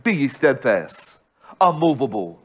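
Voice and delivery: ordinary delivery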